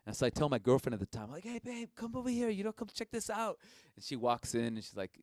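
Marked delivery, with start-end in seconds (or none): 1.4-3.6 high, eager call across the room; excited calling out. Retold or imitated. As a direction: call her over, all worked up. Calling girlfriend to come over.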